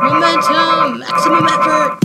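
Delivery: mock war cry; Deadpool. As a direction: charge in yelling the catchphrase. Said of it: "that's what Deadpool always says".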